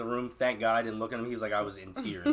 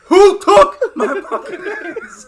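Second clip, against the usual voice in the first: high, loud, slow drawn-out yell; drunken yell; 18 dB louder, much slower than usual. Retold or imitated. Drunk guy shouting at the party about his shoes, loud slow yell. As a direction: scream it across the party, dragging every word. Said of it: "I was like".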